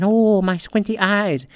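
exaggerated put-on mimicry; mocking imitation. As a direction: stretch it out slowly to mock her. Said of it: "I'm really mocking it bad".

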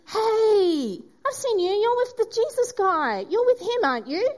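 corner him, rising with certainty; insistent accuser. Acted. Third accuser cornering Peter by the fire, high and insistent.

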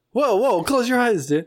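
high panicked warning to a friend; alarmed yelp. Real, not acted. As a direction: yelp at him to shut his eyes. Catching Alex peeking.

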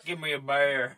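loud, garbled mock mumble; gumball-mouthed. Co-host joins in mocking the actor, garbled. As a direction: exaggerate a jutted-jaw, mouth-full slur. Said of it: "like he had a gumball in his mouth".

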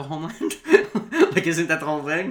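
laughing through the question. Real, not acted. Laughing at her own point.

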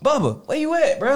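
high call across the house; calling for brother. Retold or imitated. Big brother calling the little one through the house. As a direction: sing out his name, searching. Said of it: "I'm like".

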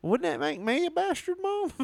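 put-on very high little-kid question; mock child. Son asking his mom about the word bastard, high mock-innocent voice.

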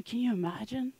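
ordinary talk, nothing marked

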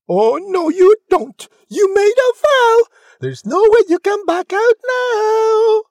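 screeching, very loud high objection; outraged ugly sister. Acted. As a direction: shriek it, building to the end. Ugly sister refuses to release prince.

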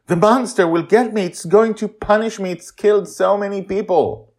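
put-on a boy scared of the monster; a frightened boy insisting the monster will punish him. Frightened dreamer boy pleads.

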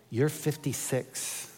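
soft and slow, with a laugh under it; gentle reveal. Retold or imitated. Wife correcting her husband's age in bed, soft and slow. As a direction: let the news land gently.

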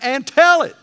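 high, loud preacher's cry; shouted exhortation. Acted. Preacher crying out to the church to tell their story, a loud shout. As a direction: belt it out to the back pew. Sermon climax, exhorting congregation.

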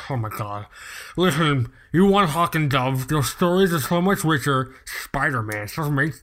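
a hint of a snobby comic-shop neckbeard. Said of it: "I did the neckbeard voice".

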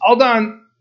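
put-on high, small child's voice; little girl voice. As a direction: say it like a proud little kid. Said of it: "the little voice on the other side says".

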